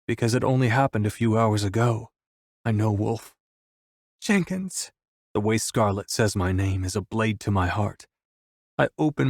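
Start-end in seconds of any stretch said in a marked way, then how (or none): 4.2-4.9 high, slightly subdued appeal; a woman calling for comfort, pitch lifted; grief-stricken call. A narrator's reading. Scarlet turns to Jenkins for comfort, grieving.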